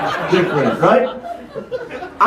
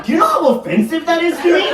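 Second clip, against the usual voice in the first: higher, indignant shout; mock outrage; 7 semitones higher than usual. Comedian feigning offence about not being checked, indignant shout. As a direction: play offended.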